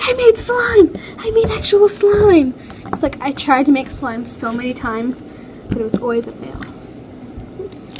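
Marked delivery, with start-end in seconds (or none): 0.0-2.5 squealing with excitement at a first success; excited squeal. Retold or imitated. A kid shrieking over her first real slime, overjoyed. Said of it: "I was, like, freaking out".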